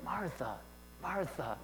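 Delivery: soft tender call; compassionate. Retold or imitated. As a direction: say her name with compassion. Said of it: "he says it compassionately".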